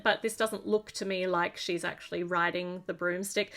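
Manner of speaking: ordinary delivery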